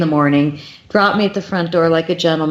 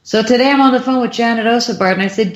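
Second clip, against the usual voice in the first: ordinary talk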